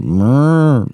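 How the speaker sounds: high mock machine growl; robotic growl noise. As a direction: imitate a lumbering machine with a growl. Imitating roaming solar panels.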